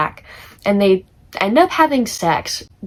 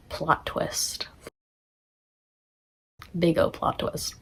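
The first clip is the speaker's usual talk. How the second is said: whispered reveal; 9 dB quieter, slower than usual. Acted. Dramatic reveal of plot twist.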